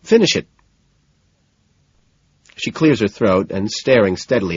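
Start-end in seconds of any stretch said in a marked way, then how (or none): 0.0-0.5 high, loud, insistent order; insistent urging. Acted. Man ordering his date to finish reading, insistent. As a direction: press hard for the ending.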